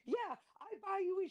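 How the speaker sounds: high mock voice; mock Magnum. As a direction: toss it back up high in disbelief. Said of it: "he was like".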